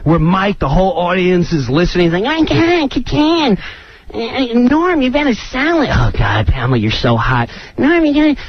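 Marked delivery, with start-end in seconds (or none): 2.2-5.9 high-pitched frantic whining; hammed-up, high, agitated nagging; Chris Kattan. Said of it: "He starts up again". 5.9-7.5 low, smitten aside; mock flirting. Castmate switches to flirting with guest. 7.8-8.4 high, frantic castmate voice; mock nagging. Castmate switches back to nagging.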